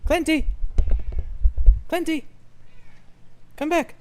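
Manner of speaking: high, loud call after a pet. Real, not acted. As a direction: call the cat back across the yard. Calling the runaway cat.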